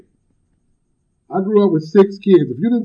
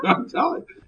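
mock out of breath; 3 semitones higher than usual. Joke newcomer back from running, mock winded. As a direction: blow out a breath and complain winded. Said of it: "breathing hard".

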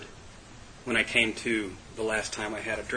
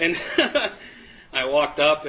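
ordinary talk, nothing marked

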